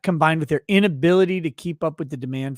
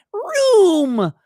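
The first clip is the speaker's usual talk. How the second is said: fake engine sound; 12 semitones higher, 4 dB louder than usual. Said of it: "The speakers are".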